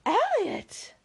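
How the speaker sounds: high-pitched cry of surprise; astonished exclamation. Acted. The party repeating the stranger's name, astonished exclamation.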